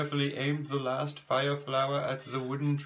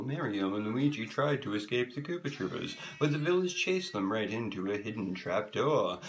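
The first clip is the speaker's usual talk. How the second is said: usual speaking voice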